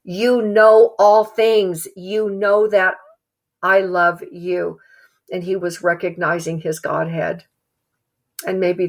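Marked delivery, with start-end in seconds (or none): 0.0-4.8 slow, deliberate avowal; slow, understated heartfelt Peter; slow earnest confession. Retold or imitated. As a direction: linger on every word as if humbled. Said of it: "maybe him saying".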